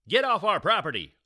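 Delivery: angry shout. Acted. Dad confronting stranger.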